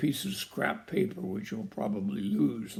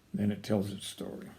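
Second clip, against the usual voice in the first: ordinary delivery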